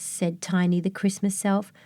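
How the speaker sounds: ordinary delivery, nothing marked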